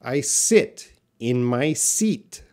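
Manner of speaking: slow, clear "I sit in my seat" with short i then long ee; slow practice sentence. Shown as a demonstration. Teacher modelling a practice sentence slowly. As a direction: say it slowly, each word clear.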